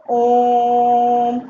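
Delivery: ओम held long and slow, teacher showing the extra length; drawn-out pluta vowel. Shown as a demonstration. Teacher to students, showing how long a pluta vowel lasts: ओम drawn out slowly. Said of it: "आप उच्चारण करिए और देखिए".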